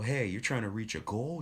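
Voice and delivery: ordinary delivery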